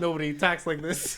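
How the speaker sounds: put-on light Chicago accent in character; villain with a mild Chicago accent. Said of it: "somebody hated my, uh, Chicago accent".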